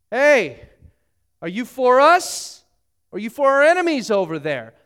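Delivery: loud, high call to a stranger at a distance. Acted. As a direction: shout across the field, challenging. Joshua calls out to stranger.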